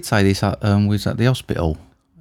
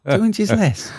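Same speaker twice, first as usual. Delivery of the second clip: mock title; 11 semitones higher, slower than usual. Mocking friend's podcast nickname.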